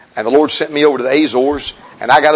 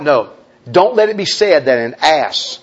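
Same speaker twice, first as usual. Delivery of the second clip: ordinary delivery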